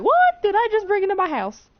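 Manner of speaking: high-pitched, hammed-up disbelief; mock shock. Boy seeing the girl close a door by mind, shocked. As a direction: exclaim it high, acting freaked out.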